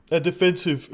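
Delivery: words stretched through a yawn. Real, not acted. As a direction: say it mid-yawn. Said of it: "pardon the yawn".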